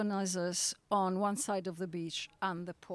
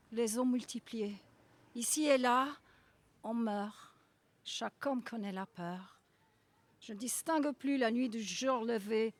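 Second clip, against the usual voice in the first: poem recitation; 3 semitones higher than usual. Acted. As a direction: read it as a grave remembrance of war. Reads French poem aloud.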